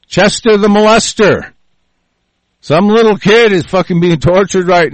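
rising furious shout ending in a taunt; angry shout. Real, not acted. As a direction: yell it at the Trump lovers. Angry rant at Trump lovers.

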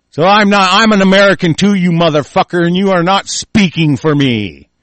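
loud, forceful rebuttal; angry defiance. Real, not acted. Host telling officials they don't speak for him, angry. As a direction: push the words out in real indignation.

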